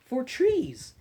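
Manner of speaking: slow storybook read-aloud; reading to children. Acted. Librarian reading an Earth book to kids, storybook voice. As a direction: read it gently to a class of little ones.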